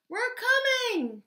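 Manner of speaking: high, drawn-out call; crying out. A narrator's reading. Hedgehog calls to trapped mice, high cry. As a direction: sing out to those waiting for rescue. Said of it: "cried Little Hedgehog".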